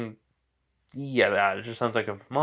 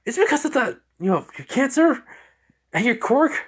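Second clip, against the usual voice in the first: very high, agitated explanation; agitated exclaiming; 13 semitones higher, 4 dB louder than usual. Acted. Creature blaming the boy's cancer and quirk, agitated. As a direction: pitch it high and urgent. Venom exclaims over the cause.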